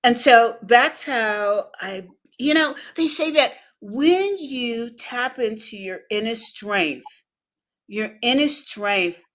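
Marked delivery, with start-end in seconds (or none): none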